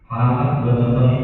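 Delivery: ordinary delivery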